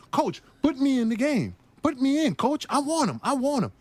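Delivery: high, pleading cries to the coach; eager begging. Retold or imitated. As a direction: beg to be sent in, way up high. Rookie begging coach to play.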